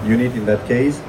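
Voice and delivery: ordinary talk, nothing marked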